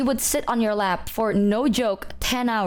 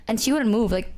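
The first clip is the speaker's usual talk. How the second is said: ordinary talk, nothing marked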